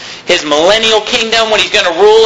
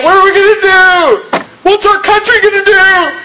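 much higher, mock-worried fretting; mock panic; 11 semitones higher than usual. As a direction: play the frantic worrier. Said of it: "be awake at night".

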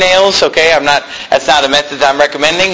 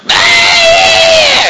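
sudden high yelling "Ah!"; mock parent yell; 4 dB louder than usual. Parent yelling at a misbehaving child, mock scream. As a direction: blurt out a shrill mock scream at the kids. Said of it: "Yelling, screaming".

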